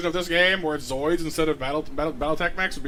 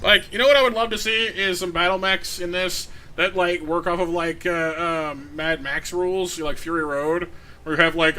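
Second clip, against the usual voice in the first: ordinary talk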